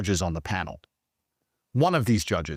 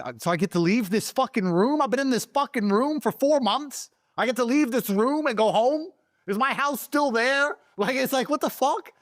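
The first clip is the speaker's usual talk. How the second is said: stunned excitement; 13 semitones higher than usual. Real, not acted. Reliving shock of going home.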